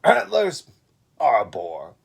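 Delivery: loud, proud lion boasting. Acted. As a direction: show off the golden mane.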